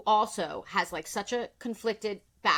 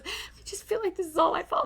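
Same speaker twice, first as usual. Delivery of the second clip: mock sobbing; 8 semitones higher than usual. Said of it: "Kyle's sobbing. She goes".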